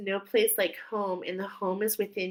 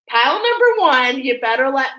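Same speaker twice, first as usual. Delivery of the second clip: a loud, higher burst of excitement; excited call-out; 5 semitones higher, 10 dB louder than usual. Real, not acted. Reader excitedly calls out pile one to her viewers. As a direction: announce the pile with real excitement.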